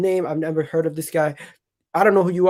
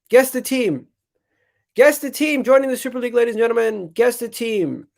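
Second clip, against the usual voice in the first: hype announcer; 6 semitones higher than usual. Acted. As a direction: tease the reveal to the chat like a show host. Hyped reveal for the chat.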